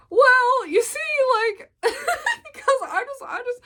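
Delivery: high, giggly awkward explanation; nervous laughing. Retold or imitated. Explaining to Mac, high and laughing nervously. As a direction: laugh your way through the excuse.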